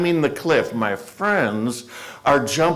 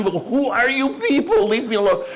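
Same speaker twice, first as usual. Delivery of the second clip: exasperated; 9 semitones higher than usual. Retold or imitated.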